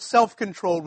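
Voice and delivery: SELF punched out, then a pause before "controlled"; stress on SELF. Shown as a demonstration. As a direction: lean hard on "self" in "self-controlled", setting it apart. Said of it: "with the emphasis on the word".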